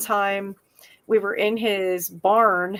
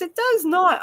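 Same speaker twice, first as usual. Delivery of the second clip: higher-pitched imitation of mom's denial; mom's firm denial; 8 semitones higher than usual. Mom correcting daughter about brown cows, disbelieving. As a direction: contradict the child, pitched up. Mom denying child's claim.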